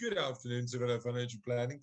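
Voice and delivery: low, brisk posh receptionist; put-on business phone manner. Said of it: "putting my posh voice on".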